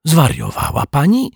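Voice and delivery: high, choked-out outburst; sputtered. A narrator's reading. Detective sputters at the girl feeding the kangaroo, high and shocked. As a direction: blurt it out in shock. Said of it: "wykrztusił detektyw Pozytywka".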